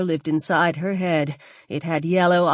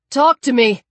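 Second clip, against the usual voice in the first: calling out; 5 semitones higher, 5 dB louder than usual. Acted. Calling out across ice.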